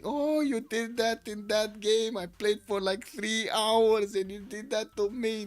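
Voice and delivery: high, slow, aggrieved player grumbling; lightly voiced sulky gamer; whiny player quote. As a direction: play the wronged player who sat three hours.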